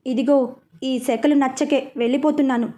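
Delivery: usual speaking voice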